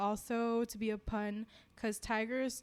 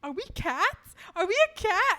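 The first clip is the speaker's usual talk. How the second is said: high, loud puzzled questions; excited retold bewilderment; mock confusion; 9 semitones higher, 8 dB louder than usual. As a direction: re-enact baffled disbelief, pitched way up. Acts out own confusion while reading.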